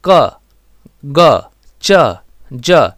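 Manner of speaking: क, ग, च, ज as short, loud separate syllables; teacher drilling short क, ग, च, ज; short vowel demo. Shown as a demonstration. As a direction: punch out क, ग, च, ज one at a time, vowels kept short. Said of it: "ये ह्रस्व उच्चारण में आता है".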